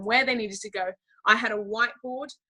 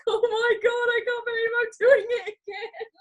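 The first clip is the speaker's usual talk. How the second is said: very high, squealed tearful wail; hammed-up sobbing, imitating herself; mock bawling; 14 semitones higher, 5 dB louder than usual. Imitating herself bawling on the last day, mock crying. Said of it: "bawling my eyes out".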